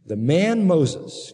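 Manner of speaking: each word drawn out slowly; solemn, very slow quotation; slow scripture reading. Shown as a demonstration. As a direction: read it slow so it sinks in. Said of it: "Listen to this statement".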